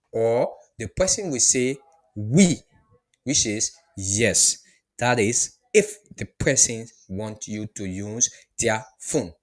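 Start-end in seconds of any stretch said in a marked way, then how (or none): none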